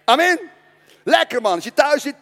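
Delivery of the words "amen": loud, high cry of praise; preacher's shout. Real, not acted. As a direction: shout it out to the whole room. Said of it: "De komende twee weken".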